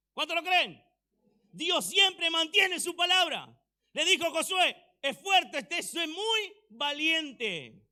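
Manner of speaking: loud, high preaching shout; fiery sermon climax; preaching at full force. Real, not acted. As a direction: preach it out, rising to a roar.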